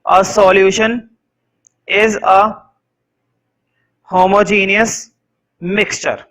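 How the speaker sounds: measured dictation with pauses between words; teacher dictating a definition; slow dictation. Shown as a demonstration. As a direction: let them write each word.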